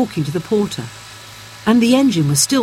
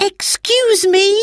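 put-on high girl's call, a bit louder; Roberta calling again; 13 semitones higher, 3 dB louder than usual. A narrator's reading. Girl calling to the driver over engine noise. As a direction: try once more to be heard. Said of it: "tried to speak to the driver".

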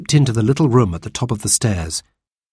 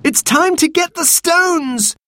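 high, stretched-out battle call; rally the others with a shout; boy's high, excited yell; urgent shout; slower than usual. A narrator's reading. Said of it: "shouted Robert".

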